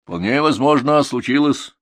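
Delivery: high, loud, slow declaration; self-important, drawn-out verdict; authoritative. A narrator's reading. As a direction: announce it like a know-all. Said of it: "авторитетно заявил Пашка-шофёр".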